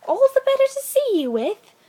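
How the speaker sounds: very high, loud sly granny voice; wolf hiding behind a squeaky old-lady voice; wolf as grandmamma. A narrator's reading. Wolf in bed answering the girl, high and loud.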